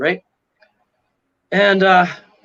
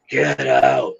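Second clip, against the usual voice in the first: low, drawn-out booming-ghost imitation; big ghostly voice; 4 semitones lower than usual. Ghost ordering intruders out of a haunted church, big low voice. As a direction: imitate a big voice commanding from all around. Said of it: "I hear this big voice saying".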